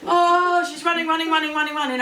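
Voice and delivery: loud, high, headlong repetition; frantic narration. A narrator's reading. Storyteller as the woman flees the bear, frantic. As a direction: race along with her in panic.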